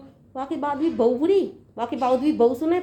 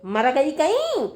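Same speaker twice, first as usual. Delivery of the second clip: drawn-out, raised scolding call; irritated calling out; 4 semitones higher, slower than usual. Acted. Mother-in-law summoning her daughter-in-law for medicine, irritated calling out. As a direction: call crossly to someone out of earshot.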